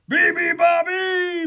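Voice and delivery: high, loud animal bellow; full-voiced mocking bird call. A narrator's reading. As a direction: bellow it like noisy bears. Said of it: "bellowed the bears".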